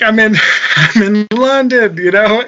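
ordinary delivery

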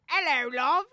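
mock British accent. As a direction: greet her lightly in a put-on British accent. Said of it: "our British impression".

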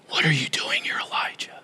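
very soft, breath-only question; hushed, intimate questioning; whisper. Acted. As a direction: whisper it gently, close to his ear. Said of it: "when Elijah heard the whisper".

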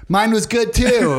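high, slow mock-earnest robot voice; deadpan robot reply. Robot operator answers the caller's small talk in high, slow mock earnest. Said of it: "they're like".

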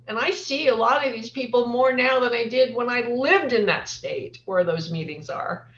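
normal talking voice

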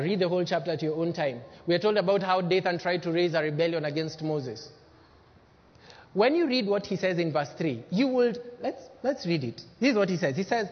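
normal talking voice

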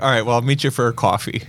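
ordinary talk

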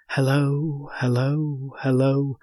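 repeated, evenly spaced distant echo of a call. A narrator's reading. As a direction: voice the echo off the cliffs. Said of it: "came the echo from the cliffs".

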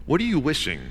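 normal talking voice

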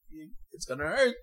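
ordinary delivery

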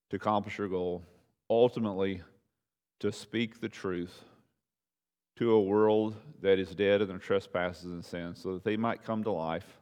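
soft, reverent closing prayer. Acted. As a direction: pray quietly to God.

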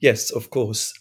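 normal talking voice

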